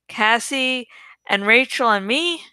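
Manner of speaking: plain reading voice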